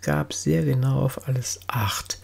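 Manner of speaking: usual speaking voice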